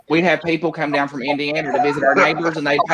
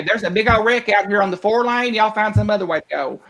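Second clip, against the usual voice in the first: higher, country-style traffic report; folksy Appalachian news voice; Southern traffic report; 5 semitones higher than usual. Retold or imitated. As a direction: report the wreck like a small-town local. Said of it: "You say".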